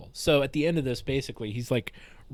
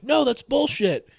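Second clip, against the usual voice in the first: much higher and louder, an indignant outburst; outraged protest; 14 semitones higher, 9 dB louder than usual. Retold or imitated. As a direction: shout it like the call is rigged. Player protesting the ruling.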